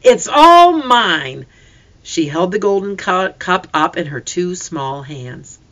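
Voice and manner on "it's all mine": high, loud and slow, proudly possessive; child clutching her prize; excited claim. A narrator's reading. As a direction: draw it out like a proud little child. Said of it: "the little one cried".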